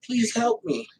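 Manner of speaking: small, soft mock plea; imitated begging. Host mimicking someone pleading to the system, soft mock plea. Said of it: "You sound like that when you keep going".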